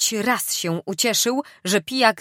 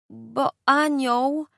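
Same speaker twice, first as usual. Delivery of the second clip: moaning; 6 semitones higher, slower than usual. Acted. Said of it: "żeby wyjęczeć".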